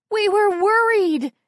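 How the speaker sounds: high, loud, slow yell. Acted. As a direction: yell it out, worried sick. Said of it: "June yells".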